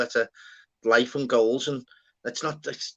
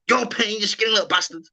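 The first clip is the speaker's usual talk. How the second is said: mock blurt, pitched up high; imitated Tourette's outburst; 7 semitones higher than usual. Friend retells a first-date outburst to the lads, mock blurting.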